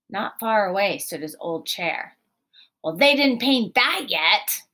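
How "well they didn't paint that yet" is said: louder, higher defiant shout. Acted. Peter shouting defiantly about his old chair. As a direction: yell it in protest. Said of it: "Peter shouted".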